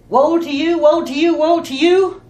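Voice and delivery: mock fiery rebuke, building; condemning rebuke. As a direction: thunder it at the Pharisees like a prophet. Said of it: "When he cut down on the Pharisees".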